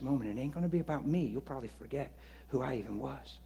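very soft, intimate reassurance; reassuring a sick stranger, very softly; hushed reassurance. Shown as a demonstration. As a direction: speak low and gentle. Gentle persuasion demo.

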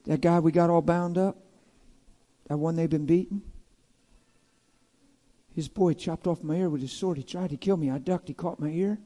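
a hint of a dazed, quiet servant; Malchus voice. Malchus quietly recounting how his ear was cut off.

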